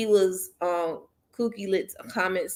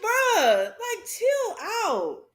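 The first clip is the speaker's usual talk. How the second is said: laughing; 13 semitones higher, much slower than usual. Retold or imitated. Telling the commenter to calm down.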